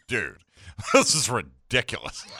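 low, soft mock groan of defeat; imitating cohost. Host mimicking cohost giving up on air, mock fed-up. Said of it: "he just called it, like".

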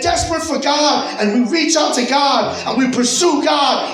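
impassioned sermon crescendo, half-sung at the end; preaching at full force. Acted. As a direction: drive the point home to the congregation. Preacher building to climax.

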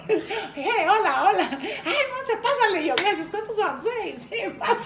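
high, breezy celebrity greeting act; laughing, high-pitched VIP swagger; Montserrat Oliver. Acted. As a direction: play the star waved through the crowd. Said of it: "actitud Montserrat Oliver".

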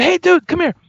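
high-pitched beckoning call. Retold or imitated. Guitarist summons fan in rebel-flag shirt, calling high. Calls the man over.

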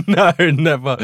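laughing through a sarcastic no. Real, not acted. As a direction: giggle it out, sarcastic. Sarcastic denial while laughing.

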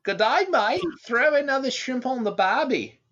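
high, loud mock Australian accent; a cartoon Australian dragon, hammed-up in a high Aussie accent. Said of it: "would you like to hear my Aussie dragon".

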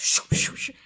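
quick voiceless whooshes; airy whooshing sound effect; imitated vortex whoosh. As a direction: make the vortex rush past in bursts. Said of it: "I just see this vortex".

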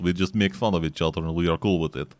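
put-on a lightly done joking Russian accent, "In Soviet Russia"; mock Russian accent. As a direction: play a hammed-up Russian bit with a light Russian accent. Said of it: "every time you start doing a Russian accent".

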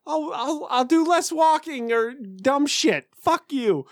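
high, hammed-up indignation; mock angry retort. Man arguing with the voice in his head, mock outrage. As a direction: snap back in exaggerated high-pitched protest.

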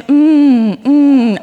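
loud exaggerated awkward hums; mock embarrassed hum. Preacher mocking silent prayer meeting, loud hums. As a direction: ham up a room of awkward murmurs. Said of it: "a room full of embarrassing".

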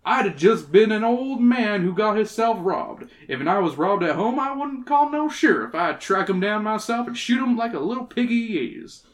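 put-on folksy old prospector telling his tale. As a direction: spin it out like a rustic old-timer. Reads old prospector's dialogue.

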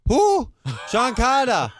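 high, laughing mock yell; restaurant worker. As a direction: squeal it back like the confused cook. Restaurant worker voiced in prank story.

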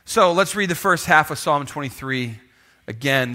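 ordinary talk, nothing marked

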